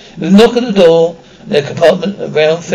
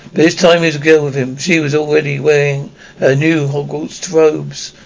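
ordinary delivery

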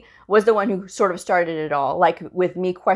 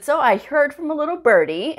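lilting, higher, coy little-birdie tease; playful singsong gotcha; teasing sing-song; 7 semitones higher than usual. Retold or imitated. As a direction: tease him sweetly, like sharing gossip. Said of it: "and I said it just like that".

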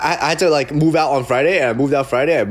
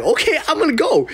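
naive eager reply; 11 semitones higher than usual. Retold or imitated.

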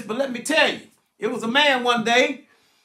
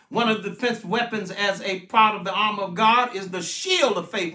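normal talking voice

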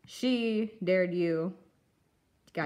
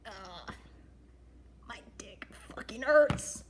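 pained whisper; 5 dB quieter, slower than usual. Acted.

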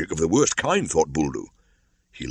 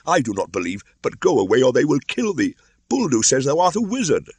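urgent plea; 9 semitones higher, 5 dB louder than usual. A narrator's reading.

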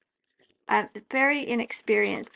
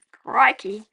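Australian crikey; 8 dB louder than usual. Pretend. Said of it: "That's a very Australian thing to say".

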